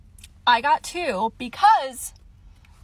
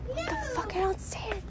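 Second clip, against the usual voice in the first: shocked disbelief; 11 semitones higher, 9 dB quieter, faster than usual. Real, not acted. Product missing from the shelf.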